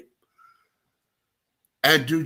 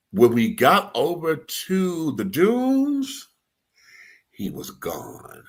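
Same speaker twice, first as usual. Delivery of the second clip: sad letdown; 6 semitones higher, much slower than usual. Real, not acted. Sad reveal of his leaving.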